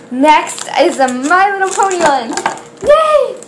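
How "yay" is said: high, loud cheer; squealing yay; excited yay. Real, not acted. Cheering at next bag.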